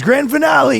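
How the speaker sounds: high-pitched announcing holler; imitated yell. Imitating guy at fireworks, high yell. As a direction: mimic a man crying out to the crowd. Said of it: "He probably yelled out nine times".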